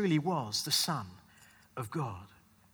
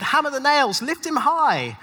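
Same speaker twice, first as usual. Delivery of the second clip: higher, louder call for the crucifixion; crowd's cry; 7 semitones higher, 7 dB louder than usual. Pretend. Preacher voicing the crowd at the cross, shouting. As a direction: shout it as one of the mob. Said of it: "That you had said".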